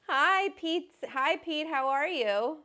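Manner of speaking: high, loud happy hello; excited greeting. Real, not acted. Host greeting Pete arriving, excited and loud. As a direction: beam out a bright hello.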